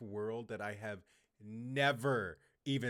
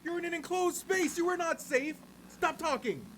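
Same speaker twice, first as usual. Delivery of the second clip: very high, loud scolding; exasperated yelling; 5 dB louder than usual. Real, not acted. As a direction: shout a frantic warning at the character. Yelling warnings at game character.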